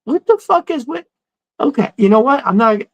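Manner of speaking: high, fed-up disbelief; thinking in outraged disbelief; exasperated inner voice. Retold or imitated. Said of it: "I'm thinking".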